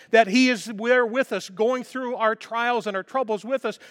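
high, loud, fervent sermon; rising preacher's passion; impassioned preaching. Acted. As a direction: build the congregation up with fire. Preaching at full force.

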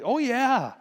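loud, high imitated agreement; emphatic agreement. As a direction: act out a big, knowing yes. Sons-in-law emphatically agree with dad.

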